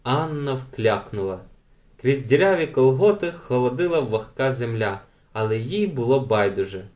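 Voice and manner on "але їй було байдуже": usual speaking voice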